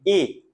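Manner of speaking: "et" as a closed é, pitched high; acute-accent sound. Shown as a demonstration. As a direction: say "et" with a tight closed "ay" vowel, no glide. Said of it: "l'accent aigu, on faisait". (et, é fermé)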